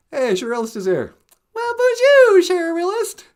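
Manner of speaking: high, loud cartoon greeting; squeaky puppet saying hello.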